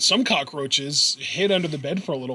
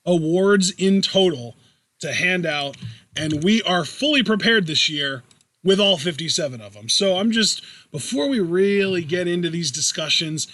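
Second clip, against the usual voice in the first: usual speaking voice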